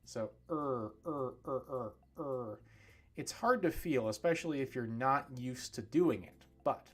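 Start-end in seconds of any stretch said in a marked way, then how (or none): 0.0-2.6 repeated throaty "er" from the back; deep throaty "er" drill; deep er sound. Shown as a demonstration. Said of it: "the er sound is much deeper".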